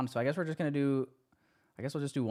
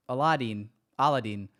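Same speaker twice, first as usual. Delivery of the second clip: ordinary delivery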